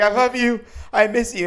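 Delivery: high, loud pleading cry; lovesick serenade. Retold or imitated. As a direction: beg up at the window like a heartbroken fool. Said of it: "a dude outside of his girl's house".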